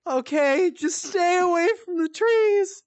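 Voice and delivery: a goofy Shaggy-ish high whine; Shaggy-like mom. Retold or imitated. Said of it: "sound like Shaggy".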